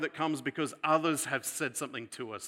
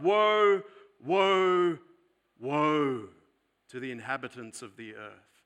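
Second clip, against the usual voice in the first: crying out woe; 9 dB louder than usual. Acted. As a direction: call out woe like a heavenly voice, then let it sink. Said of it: "there's a voice that cries out".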